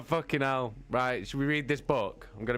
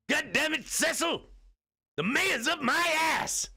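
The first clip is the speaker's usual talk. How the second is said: much higher, hammed-up bellowing chief; angry police chief. Police chief berating Cecil, much higher mock bellow. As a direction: yell at your detective like a stressed cop boss. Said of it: "Steve'd have to be like the police chief".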